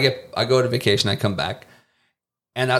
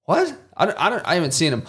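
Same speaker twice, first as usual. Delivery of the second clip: high, fast flustered answer; baffled narrator stammering; mock confusion; 6 semitones higher, faster than usual. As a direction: sputter like you're caught off guard.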